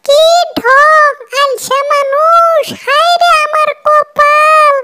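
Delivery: very high-pitched wailing wife voice; lamenting wife. Acted. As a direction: complain in a high put-on voice, stretching the phrase ends. Wife lamenting her lazy husband.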